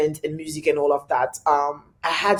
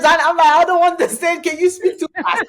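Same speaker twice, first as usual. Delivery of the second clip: high, laughing mock confusion; 12 semitones higher than usual. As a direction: play it baffled, pitched way up with a laugh.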